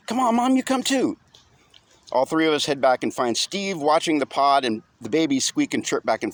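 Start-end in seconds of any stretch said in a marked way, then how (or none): 0.1-1.2 put-on high boy voice, urging; eager child. A narrator's reading. Boy urges his mother to come, high and eager. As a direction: hurry Mom along like a small kid.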